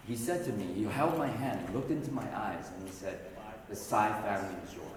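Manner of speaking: low, soft grieving retelling; quiet and sad. Real, not acted. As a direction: hold it down, heavy with loss. Telling father's death.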